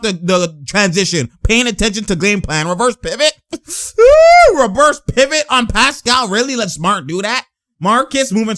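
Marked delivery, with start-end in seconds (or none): 4.0-4.6 long high, loud ooh; drawn-out high-pitched holler; amazed "ooh". Real, not acted. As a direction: whoop at a slick move. Reacting to a slick move.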